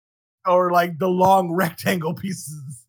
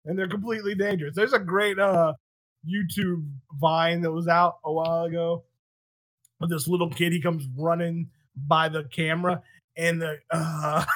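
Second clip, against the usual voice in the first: normal talking voice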